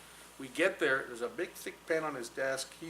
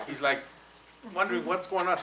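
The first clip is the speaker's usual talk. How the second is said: ordinary talk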